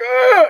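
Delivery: sudden high yelp of alarm; startled scream. Retold or imitated. Hero sees her bleeding, a sudden high yelp. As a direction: yelp in shock. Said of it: "he's like".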